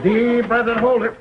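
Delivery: normal talking voice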